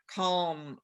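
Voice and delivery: ordinary delivery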